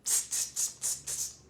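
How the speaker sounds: soft voiceless "tss" repeated in little bursts; monkey chatter. A narrator's reading. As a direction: imitate monkeys with quick hissy tuts. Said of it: "and said".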